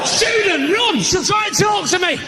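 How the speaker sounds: high, loud comic alarm; hammed-up panicked yell; mock panic. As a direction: shout a warning as if fleeing. Mock panic at educated audience member.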